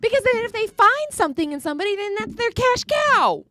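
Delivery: high, indignant outburst slowing at the end; real worked-up yelling. Real, not acted. Cohost rants to Stu about screenings, worked-up yelling. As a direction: yell it high, dragging out the final words. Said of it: "Sorry, I'm yelling".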